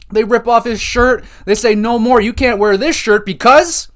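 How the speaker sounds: high, loud excited retelling; rising to a thrilled pitch. Real, not acted. Excited retelling of shirt reveal.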